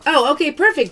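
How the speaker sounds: ordinary talk, nothing marked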